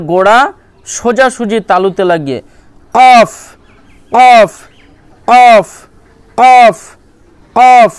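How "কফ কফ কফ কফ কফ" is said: কফ hit loud and high from the tongue root; qaf demo. Shown as a demonstration. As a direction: punch each কফ from the back of the tongue. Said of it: "এইভাবে উচ্চারণ করবেন". (জিভের গোড়া থেকে জোরে কফ)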